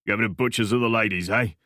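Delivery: put-on quick thick Cockney accent, sly question; Cockney bloke sizing up the boy. A narrator's reading. Stranger in a cafe questioning Alex, thick Cockney accent. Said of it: "thick Cockney accent".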